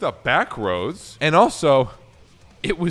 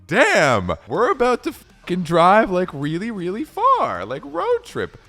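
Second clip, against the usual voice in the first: louder, rising excitement; hyped up; 6 semitones higher, 3 dB louder than usual. Retold or imitated. To his girlfriend before the drive, excited hyped voice. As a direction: get pumped for the road trip. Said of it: "So I'm like".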